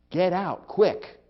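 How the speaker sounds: loud, high-pitched, urgent warning; urgent command. Shown as a demonstration. Firefighter warning people in a burning house, urgent. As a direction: order people out with great emphasis. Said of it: "spoken with a great deal of urgency".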